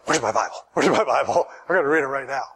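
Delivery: low, urgent grab for the Bible; eager to read. Pretend. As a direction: hurry as if caught out by the devil. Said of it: "I'd be like".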